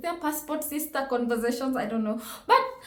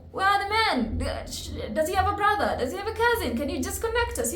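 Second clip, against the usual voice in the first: higher, pleading, excited sister voice; eager sisters; 8 semitones higher than usual. Retold or imitated. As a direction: playfully beg for a man. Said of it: "my sisters are like".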